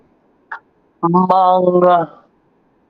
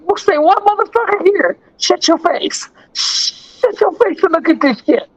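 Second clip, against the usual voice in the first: high, mock-tough voice showing off; retold own retort; 10 semitones higher than usual. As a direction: play yourself hyped and bossy, pitched up. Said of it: "I was like".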